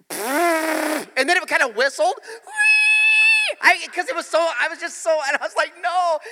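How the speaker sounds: high, loud, slowed cry building to a yelled no; rising shout. Retold or imitated. Preacher telling the congregation his school disaster, shouting. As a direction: blurt it out in horror. Climax of embarrassing story.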